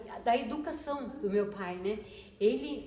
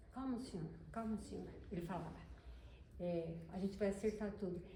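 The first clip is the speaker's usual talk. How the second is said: soft calming plea; 2 semitones lower, 9 dB quieter than usual. Retold or imitated. Gentle father calming the police.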